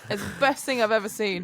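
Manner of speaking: normal talking voice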